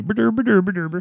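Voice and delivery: high, silly nonsense echoing the fake European babble; goofy echo of the mock accent; mock gibberish. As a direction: parrot the gibberish back playfully. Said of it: "A boosh, a boosh".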